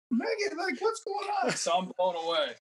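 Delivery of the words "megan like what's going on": squeaky high pleading to his wife; desperate high plea. Retold or imitated. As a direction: ask what's going on, voice way up high.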